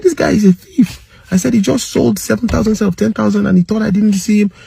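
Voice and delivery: high, heated call to the crowd; angry accusation. Retold or imitated. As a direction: denounce him as a thief. Accusing attendant to gathered crowd.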